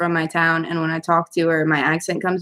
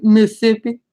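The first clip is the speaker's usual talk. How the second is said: put-on louder, teasing Mississippi accent; mock Mississippi accent; 3 semitones higher, 5 dB louder than usual. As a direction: make fun of a Mississippi accent, squashing the word. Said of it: "making fun of Crystal's accent".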